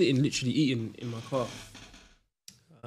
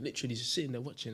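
normal talking voice

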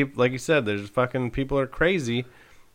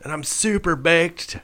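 slow, dazed stoner voice; slow, spaced-out stoner; stoned; 5 semitones higher, 4 dB quieter than usual. Pretend. Playing the stoned owner.

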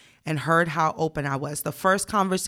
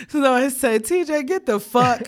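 laughing; 8 semitones higher, 4 dB louder than usual. Real, not acted. Cracking up retelling reply.

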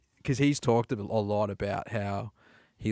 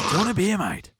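much higher, eager offer of a beer; pushy Aussie pub mate; 8 semitones higher, 3 dB louder, faster than usual. Retold or imitated. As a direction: push a beer on him like a rowdy local. Offering beer, mocking pub mates.

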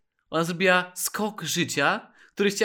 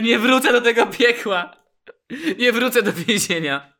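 louder, higher mock-furious refusal; enraged woman; 5 semitones higher, 5 dB louder than usual. Imagined woman refusing to return to prison, mock-angry. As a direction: play at being a furious woman digging in. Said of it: "Wyobrażam sobie taką wściekłą kobietę".

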